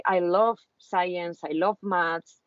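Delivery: ordinary talk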